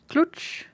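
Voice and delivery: Klutsch said with oo and a tsh ending; oo vowel, tsh ending. Shown as a demonstration. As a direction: stretch Klutsch as kloo-tsh. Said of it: "Is it a or oo?".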